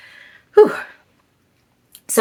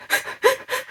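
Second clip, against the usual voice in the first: sobbing gasp; 6 dB quieter than usual. Retold or imitated. Sobbing settles into gasps.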